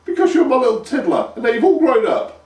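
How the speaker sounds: high, loud mother voice; doting mother. A narrator's reading. Mother explaining the nickname to her son. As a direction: soothe him with fond nonsense.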